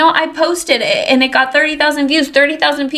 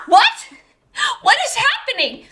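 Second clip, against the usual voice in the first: high-pitched incredulous self-quote; overwhelmed, acted-out astonishment; retold disbelief; 12 semitones higher than usual. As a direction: squeak it in mock bewilderment. Retold disbelief at sudden news.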